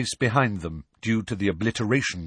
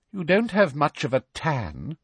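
Umbridge voice; 7 semitones higher than usual. Acted. Umbridge needles Hagrid.

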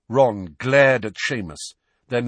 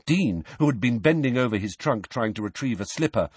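ordinary delivery

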